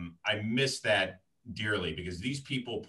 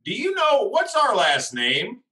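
much higher, louder, slow mock indignation; hammed-up indignant builder asking; mock outraged builder; 11 semitones higher, 8 dB louder, slower than usual. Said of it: "He's like".

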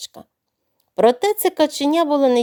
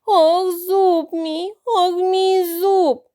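higher, very slow, whimpering wail; pitiful crying; 7 semitones higher, much slower than usual. Acted. Crocodile crying pitifully to the disguised doctor. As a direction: sob for pity to trap the doctor. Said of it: "заплакав жалібно".

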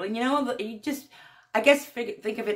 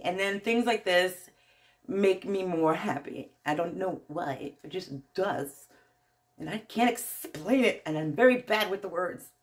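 ordinary talk

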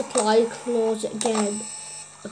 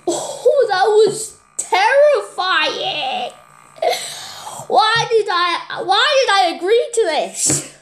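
high shaken voice, slow; scared horror game streamer; 7 semitones higher, slower than usual. Real, not acted. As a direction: still rattled, high and stretched. After jump scare.

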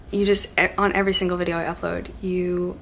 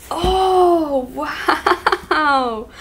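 loud, high "oh, wow" with a laugh; wowed by the candle; laughing, delighted wow; amazed; 7 semitones higher, 7 dB louder than usual. Real, not acted. Opening candle.

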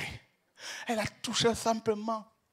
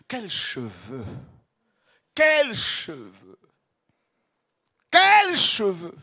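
building rapture; 9 dB louder, much slower than usual. Real, not acted. Preacher marvelling at the Lord's hair, slow build from hush to cry. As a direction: let each quels cheveux grow until it bursts.

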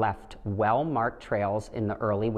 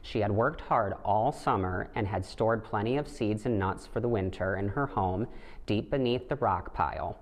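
plain reading voice

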